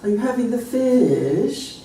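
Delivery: drawn-out British question, pitched high; British melody. Retold or imitated. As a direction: stretch FISH in a posh-style British lilt. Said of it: "we would never say it like that".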